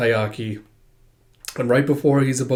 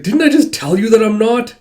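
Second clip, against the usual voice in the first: high, loud indignant retort; indignant protest; 8 semitones higher, 7 dB louder than usual. Acted. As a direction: push back at being doubted. Protesting an accusation.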